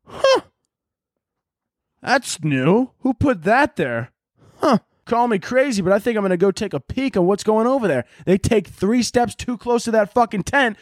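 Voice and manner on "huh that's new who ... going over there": lightly voiced dumb turkey, wondering aloud; a hint of a nosy bird; dumb curious turkey. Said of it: "And they're like".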